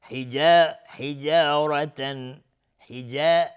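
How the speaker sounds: "حجا" with a natural long aa, chanted loud; natural madd. Shown as a demonstration. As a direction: hold the aa in "حجا" evenly, recitation style. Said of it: "مد طبیعی است". (مد طبیعی در «حجا»، با صدای بلند)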